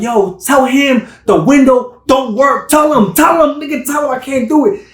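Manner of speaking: high, loud frantic pleading; panicked yelling to your friend during the traffic stop; panicked scream. Retold or imitated. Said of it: "and I'm screaming".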